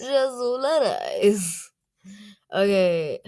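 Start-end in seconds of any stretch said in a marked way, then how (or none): 0.0-1.7 loud, drawn-out mock Italian; Italian accent. Vlogger echoing a translator voice, big slow Italian accent. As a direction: ham up the name in Italian, high and slow.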